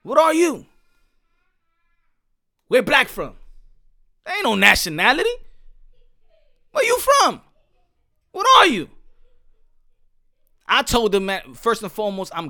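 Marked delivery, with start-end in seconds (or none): 0.0-8.9 loud, high-pitched challenge; confrontational demand. Acted. Challenging J Rock Williams about nationality, loud and confrontational. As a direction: fire each question out loudly, demanding an answer. Confronting an absent opponent with questions.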